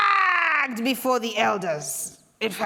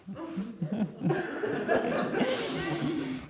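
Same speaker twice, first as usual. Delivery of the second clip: sheepish laugh; 4 semitones higher, 6 dB quieter than usual. Acted. Caught by husband.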